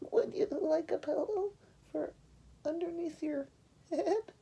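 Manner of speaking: high hushed little sheep; timid sheep. Acted. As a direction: ask ever so gently as the sheep. She said.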